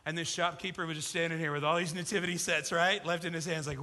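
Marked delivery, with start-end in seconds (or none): none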